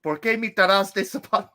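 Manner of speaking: a favorite presidential bit in Spanish; mock politician's Spanish; George Bush. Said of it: "my favorite one is".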